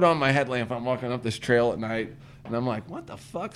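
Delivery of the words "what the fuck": very soft startled murmur; hushed. Retold or imitated. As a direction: breathe it out, spooked. Spotting glow on dark trail.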